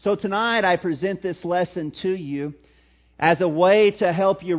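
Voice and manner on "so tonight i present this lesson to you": usual speaking voice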